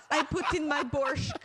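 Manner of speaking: high mock Russian with a laugh; put-on Russian accent; bad Russian accent. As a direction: giggle through a hokey Russian accent. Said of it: "this really bad Russian accent".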